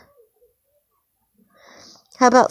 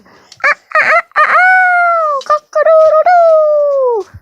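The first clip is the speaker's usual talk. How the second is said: very high, loud crowing; rooster crow; 5 dB louder, slower than usual. Pretend. Parent crowing like a rooster for a child, playful. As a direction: crow like a rooster, stretching the last note.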